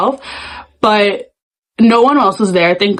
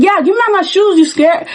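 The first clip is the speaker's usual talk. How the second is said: taunting; 9 semitones higher than usual. Retold or imitated. Taunting the girl.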